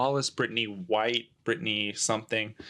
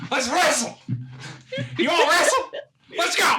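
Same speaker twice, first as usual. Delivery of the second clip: put-on Appalachian accent; 6 dB louder than usual. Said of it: "Get your mountain accent on".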